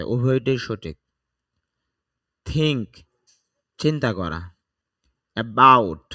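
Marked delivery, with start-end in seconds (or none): 5.4-6.0 about with the second syllable bout hit loud; stress on bout. Shown as a demonstration. Teacher showing learners stress on the bout of about. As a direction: punch bout in about, light uh. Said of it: "বার মধ্যে চাপ দিতে হবে".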